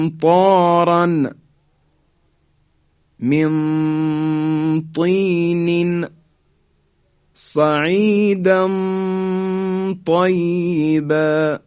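"من طین" slow with hidden nun and heavy ta; recited ikhfa examples. Shown as a demonstration. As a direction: recite each word with long nasal ghunna.

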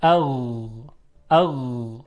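اغ with غ flowing on, uncut; soft ghayn. Shown as a demonstration. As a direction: let the غ in اغ run softly. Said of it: "صدای نرمی هست نسبتاً". (تلفظ اغ با غین نرم و کشیده)

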